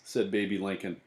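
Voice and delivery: usual speaking voice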